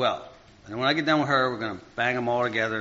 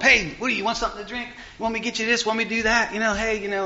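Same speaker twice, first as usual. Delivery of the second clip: high-pitched, overeager offering voice; mock eager offer; 9 semitones higher, faster than usual. Imagining the woman offering him a drink, high mock-eager voice. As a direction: pitch it up like someone pestering to help. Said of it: "She didn't come up".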